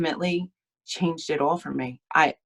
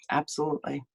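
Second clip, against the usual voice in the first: choked-up softer repeat; holding back real tears; tearful; 4 dB quieter, faster than usual. Real, not acted. Speaker tells the panel her story, getting tearful. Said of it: "Now I'm crying, guys".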